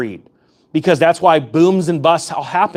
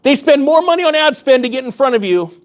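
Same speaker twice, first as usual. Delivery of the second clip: pitched higher and driving hard, real heat; fired-up rant; 6 semitones higher than usual. Real, not acted. YouTuber telling viewers the media lies, fired-up higher rant. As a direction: rail against the lies with rising fire.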